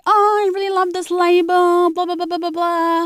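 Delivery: high, airy mock of a label-loving friend. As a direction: lightly parody a friend gushing about brands. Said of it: "some of my friends are like".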